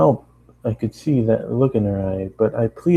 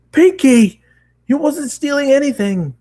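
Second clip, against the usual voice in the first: much higher and louder yell; 6 dB louder than usual. Acted. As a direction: shout her name to stop her. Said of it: "I yelled".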